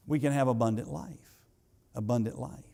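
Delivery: ordinary delivery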